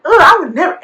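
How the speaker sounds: loud, high-pitched scornful refusal; mock disgust. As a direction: act out her offended rejection. Retold mean girl rejects the idea.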